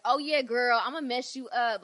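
brash, playful threat with loads of attitude; Rosie Perez. Retold or imitated. Backstage with Velvet before a match, sassy trash talk.

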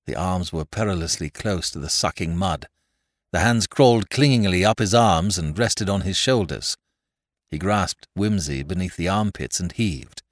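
usual speaking voice

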